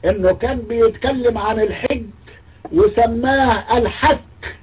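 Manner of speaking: high, drawn-out mock of the host; mocking quote. Mocking the TV host, high and slow. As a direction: stretch out الحك slowly and high in mockery. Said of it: "عمرو أديب يكمل الكلام يقول".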